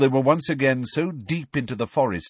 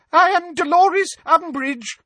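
much higher, loud, terrified; high-pitched terrified; 7 dB louder than usual. Acted. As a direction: squeak it out in terror. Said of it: "in a high-pitched, terrified voice".

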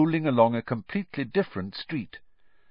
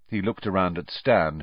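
ordinary delivery, nothing marked

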